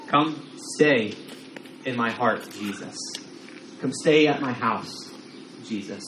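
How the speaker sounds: quiet, fading prayer to Jesus; an intimate whispered-down invitation; soft prayer. Pretend. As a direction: pray it softly, trailing off on each name.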